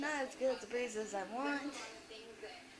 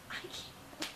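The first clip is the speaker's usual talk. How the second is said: whisper; 8 dB quieter than usual. Real, not acted. Exasperated aside in rant.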